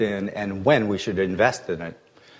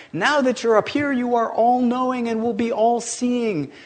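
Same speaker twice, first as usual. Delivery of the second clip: much higher, eager coaxing voice; coaxing Einstein; 9 semitones higher than usual. Acted. Einstein coaxing the shy newcomer to tell his IQ, high and eager. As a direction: wheedle him like an excited old professor.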